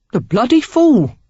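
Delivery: loud, high Cockney outburst; indignant Cockney rogue; Cockney exclamation. A narrator's reading. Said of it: "the stranger exclaimed".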